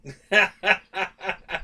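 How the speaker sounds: real laugh; amused laugh. Real, not acted. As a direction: laugh at the punchline. Laughing at own joke.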